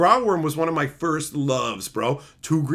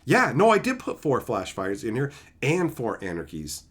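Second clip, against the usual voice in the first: usual speaking voice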